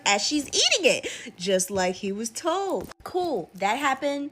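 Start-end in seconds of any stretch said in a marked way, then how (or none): none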